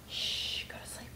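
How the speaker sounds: hushed whisper, lulling to sleep; soft unvoiced hush for a sleeping baby; whispering over a crib. Pretend bedtime for baby.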